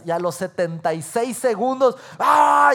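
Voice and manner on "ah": loud, high mock yell; retold angry yell. Husband losing it with his wife, mock yelling. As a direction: burst into an acted "aah". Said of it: "Ya estás gritando".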